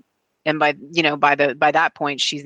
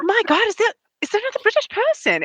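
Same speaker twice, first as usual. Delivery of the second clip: much higher, startled imitation of a friend; imitating Gemma. Retelling Gemma overhearing the accent, high mock surprise. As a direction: mimic her surprised question, pitched up. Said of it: "she was like".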